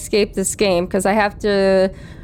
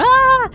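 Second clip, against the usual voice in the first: high, loud mock scream; dramatic yelp; 5 dB louder than usual. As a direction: replay the hammy scream. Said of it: "I was being dramatic, you know, going".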